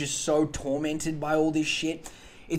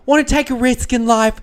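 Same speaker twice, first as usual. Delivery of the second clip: high, loud mock carefree gush; high hammed-up bride-to-be; airy daughter voice; 8 semitones higher, 12 dB louder than usual. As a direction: gush like a naive thirty-something. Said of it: "She's like".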